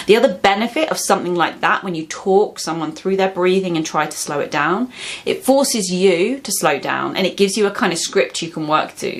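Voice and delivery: ordinary delivery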